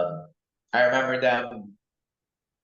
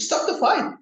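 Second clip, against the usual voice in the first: much higher, urgent yelling; retold scream; 4 dB louder than usual. Fan screaming that the knocked-down fighter is down, much higher. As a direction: shriek it like the fighter's hurt.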